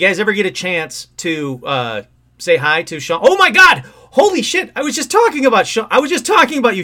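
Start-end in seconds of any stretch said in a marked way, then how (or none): 3.2-6.8 loud, high, shocked outburst. Real, not acted. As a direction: yell in real surprise. Friend suddenly joins the call.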